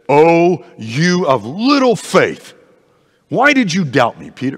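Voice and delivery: a loud, high scolding from Jesus. Pretend. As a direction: rebuke him sharply for doubting. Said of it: "maybe he was scolding Peter".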